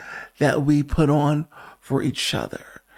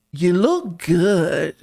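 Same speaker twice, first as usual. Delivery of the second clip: tender compliment; much slower than usual. Real, not acted. Host reassures the sleepy viewer, slow and tender. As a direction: say it slowly, like you mean it. Morning pep talk to viewer.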